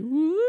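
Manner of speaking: high ghostly "woo"; short high mock-ghost wail; imitated escaping souls. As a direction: whoop it like a ghost let loose. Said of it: "bumps it, it's like".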